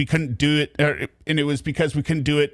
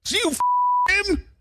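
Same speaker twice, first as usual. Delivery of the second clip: much higher, slow mock outrage; mock shock; 14 semitones higher, slower than usual. Mocking the caller's shocked reply, high, hammed-up disbelief. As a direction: squeak it out in imitated disbelief. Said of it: "And he's like".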